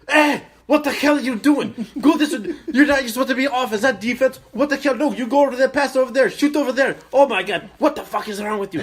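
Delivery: high, loud sideline tirade; dad yelling. Retold or imitated. Dad as backup coach swearing at players, yelling. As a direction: impersonate an irate coach bellowing orders. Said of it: "he's just swearing up a storm".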